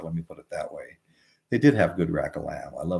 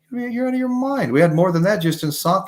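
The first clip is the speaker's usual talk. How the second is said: shocked disbelief; 11 semitones higher than usual. Retold or imitated. Shocked at the tiny sushi bill.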